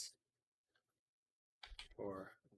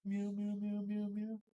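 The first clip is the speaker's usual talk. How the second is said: high, playful meows; mock meowing; 8 semitones higher than usual. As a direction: meow like a cute little cat.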